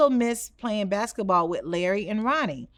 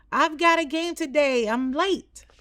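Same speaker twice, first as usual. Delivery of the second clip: yelling back; 5 semitones higher than usual. Acted. Boy to his father as he runs off, yelling back. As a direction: shout over your shoulder while running. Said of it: "Michael yelled back".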